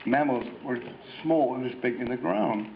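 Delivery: ordinary talk, nothing marked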